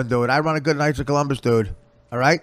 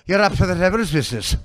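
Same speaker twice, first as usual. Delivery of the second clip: put-on higher-pitched scolding old Irishwoman; old Irish woman; 5 semitones higher than usual. Comic pretends to be a rolling-pin-wielding Irish mother, scolding old Irish woman. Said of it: "I was an old Irish woman".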